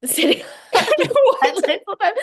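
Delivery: high and loud, breaking into laughter; can barely get it out for laughing. Real, not acted. To a co-host about the hoodie, high, loud laughter.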